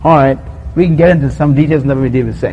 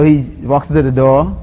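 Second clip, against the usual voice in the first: ordinary talk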